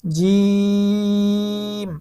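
জিম with a long held ee; three-alif stretch. Shown as a demonstration. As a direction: hold the vowel of জিম for three alifs, steady pitch. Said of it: "তিন আলিফ টান হবে". (জিম তিন আলিফ টেনে)

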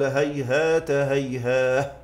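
هيهات linked as hayhaata, هيهاه drawn out to a final h; pausing on h. Shown as a demonstration. Teacher quoting two reciters' reading, slow هيهات هيهاه ending in h. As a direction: link هيهات, then slowly stop هيهاه on h. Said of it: "وقف البز والكساء بالهاء".

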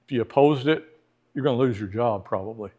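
normal talking voice

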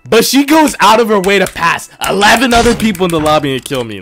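loud, high-pitched indignant rant. Real, not acted. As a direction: rant loudly at the screen. Raging at a player who killed him.

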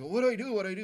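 high, loud frantic repeat; flustered owner begging for help; imitating a panicky cat owner; panicked.

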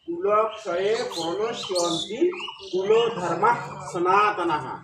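"কুল ধর্মা সনাতনাহা" slow, ending with a breathy "হা"; pronunciation demo. Shown as a demonstration. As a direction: recite slowly, sounding the final visarga as "হা". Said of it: "তাহলে উচ্চারণটা এরকম হবে". (ধীরে উচ্চারণ, বিসর্গে "হা")

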